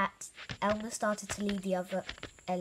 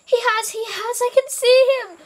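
very high, loud elephant; excited shout; 16 dB louder than usual. A narrator's reading. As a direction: shout out what you've spotted, all excited. Excited elephant spots Wilbur.

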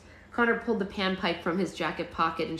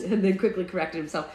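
plain reading voice

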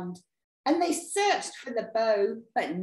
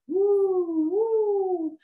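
high, drawn-out "woo" of a storm; howling wind; 9 semitones higher, 3 dB louder than usual. Acted. Storyteller acting out the stormy winds, howling wind.

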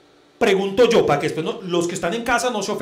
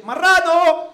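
high, loud dog call; same calling tone; 10 semitones higher, 7 dB louder, much slower than usual. Shown as a demonstration. Owner calling the dog an insult, high loud calling tone. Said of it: "en el mismo tono de voz".

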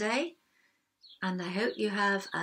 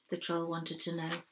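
ordinary delivery, nothing marked

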